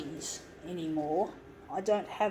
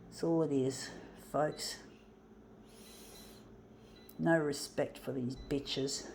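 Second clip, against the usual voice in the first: normal talking voice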